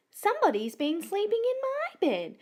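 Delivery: high-pitched indignant squeal; squealing mummy koala. A narrator's reading. Mummy koala finding her bed slept in, squealing. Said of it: "squealed Mummy Koala".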